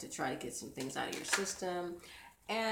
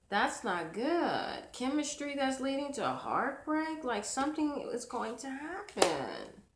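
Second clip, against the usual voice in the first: ordinary talk, nothing marked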